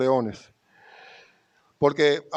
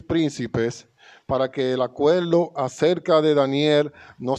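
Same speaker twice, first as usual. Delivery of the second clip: louder, measured reading of the passage; scripture reading; 4 dB louder than usual. Acted. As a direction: read the passage out to the church. Lectura bíblica en voz alta. (lectura bíblica solemne)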